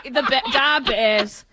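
slow, thick Chicago accent on "da Bears". Shown as a demonstration. Joking to the co-host in a hammed-up Chicago accent. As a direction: drag out "da Bears" like a Chicago sports fan. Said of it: "I guess I can do my Chicago".

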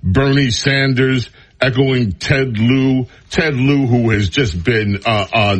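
usual speaking voice

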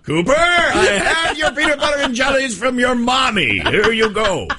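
high, laughing imitation of the stranger; laughing mock stranger. Stranger hands the boy his sandwiches from mom, laughing impression. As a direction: laugh through the stranger's lines.

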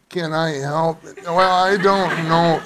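slow, limp helpline drawl; lightly mock a listless helpline worker; a hint of bored Valley Girl helpline voice; mock Starbucks voice. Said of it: "It's the worst voice".

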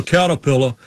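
"Caterpillar" with the R's dropped, like "cattapilla"; "Caterpillar" ending in an open "ah", no R. Retold or imitated. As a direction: imitate someone who can't say the R's in "Caterpillar". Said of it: "he couldn't pronounce R's".

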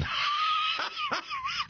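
high, soft, wheezy run of laughter; cracking up. Real, not acted. Host cracks up over the strip-club ministry, helpless laughter. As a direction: lose it at your own joke. Cracks up at his own joke.

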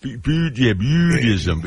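high, loud, drawn-out southern "Buddhism"; Parker Posey's odd pronunciation. Retold or imitated. Cohost imitating the actress's weird Buddhism, exaggerated southern accent. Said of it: "She also pronounced Buddhism weird".

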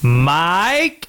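long rising call; calling out, questioning. Real, not acted. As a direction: check if the caller is still there. Calling a silent caller on air.

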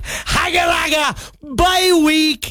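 sing-song mock gloat; gloating taunt. Host mocking fantasy rivals during bye week, gloating. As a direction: rub it in, pleased with yourself.

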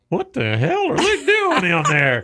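high, slow baffled exclamation; puzzled driver. Retold or imitated. Listener driving by hears the cat song, high baffled outburst. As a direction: play a bewildered driver hearing the radio. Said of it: "We got a guy driving down the road going".

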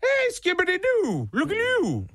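starstruck greeting. Retold or imitated. Fan greeting a star neighbor, giddy and high. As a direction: gush at the celebrity next door.

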